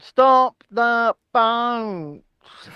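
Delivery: hugely stretched, solemn three-word slogan; one slow word at a time, pompously; Rishi Sunak. Acted. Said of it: "I mean, three-word slogan".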